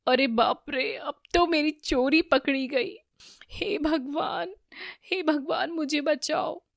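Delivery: high, frightened lament; panicked. A narrator's reading. Damini caught stealing begs to be saved, panicked. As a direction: plead for rescue in a high panicked voice. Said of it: "तभी दामिनी घबराते हुए कहती है".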